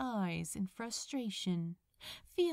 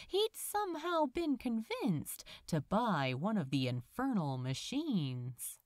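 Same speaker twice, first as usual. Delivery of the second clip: ordinary delivery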